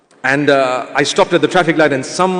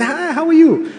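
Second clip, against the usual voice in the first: friendly greeting; 10 semitones higher than usual. Retold or imitated. Smiling greeting to the woman.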